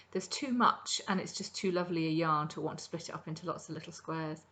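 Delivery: normal talking voice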